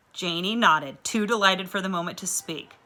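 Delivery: usual speaking voice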